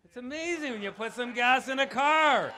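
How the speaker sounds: loud and high, tongue in cheek; loud sarcastic punchline. Real, not acted. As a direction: land the joke on the gas loudly. Punchline of car story.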